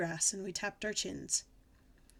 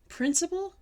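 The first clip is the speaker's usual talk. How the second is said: high, louder incredulous question; very shocked; 9 semitones higher, 5 dB louder than usual. A narrator's reading. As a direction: ask in sharp disbelief. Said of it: "I asked, very shocked".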